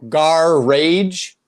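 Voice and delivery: GAR RAGE split in two, RAGE loud and drawn out; exaggerated garage. Shown as a demonstration. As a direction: blare "Gar rage" as two separate words, questioning. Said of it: "I've heard people call it".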